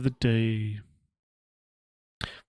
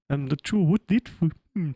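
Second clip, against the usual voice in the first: ordinary delivery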